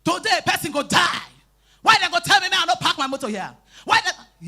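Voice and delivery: hammed-up furious shouting; retold angry shouting. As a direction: yell like a man furious about his parked car.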